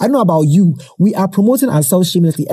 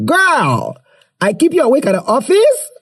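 high, incredulous question; surprised disbelief; 8 semitones higher than usual. Real, not acted. Host reacting to a listener's Instagram praise, high surprised disbelief.

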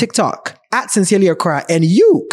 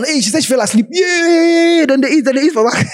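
mocking social media hype; 9 semitones higher than usual. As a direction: ham up a gushing online post. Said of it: "somebody has said on social media that".

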